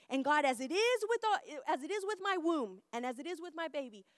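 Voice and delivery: high, fervent declaration that gradually eases; impassioned prayer, settling as it goes; excited bold prayer. Acted. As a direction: proclaim it boldly to God. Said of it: "I was so excited".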